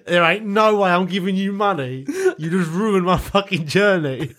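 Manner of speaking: high, mocking inner refusal, slowing at the end; scornful inner voice. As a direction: scoff at the beggar in your head. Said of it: "And I'm thinking".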